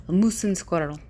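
short mock-Russian catchphrase; Russian accent. Shown as a demonstration. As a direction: try the line in a Russian accent. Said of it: "the Russian accent".

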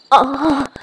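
piercing moan. A narrator's reading. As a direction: let out a sharp, loud cry. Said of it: "piercing moan".

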